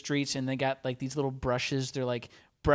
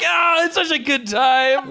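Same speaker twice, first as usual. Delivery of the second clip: cheery crowd voice; 10 semitones higher, 10 dB louder than usual. Retold or imitated.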